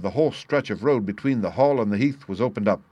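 ordinary delivery

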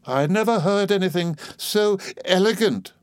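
high, prim sarcastic voice; Miss Murdstone. Acted.

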